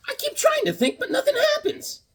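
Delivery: very high squeaky cartoon voice with laugh; Stooge impression. Fan reading a Three Stooges card, squeaky comic voice. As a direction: squeak it like a slapstick clown.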